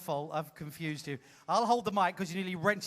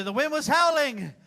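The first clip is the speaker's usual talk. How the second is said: builds from louder to a shouted, higher climax; melodramatic narration; 7 semitones higher, 6 dB louder than usual. Acted. Host narrating the pantomime storm, building to a shout. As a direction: ham up the stormy night.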